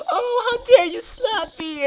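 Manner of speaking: very high, drawn-out fake wail; mock a slapped crybaby, slow and squeaky; mock crying. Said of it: "And then start crying".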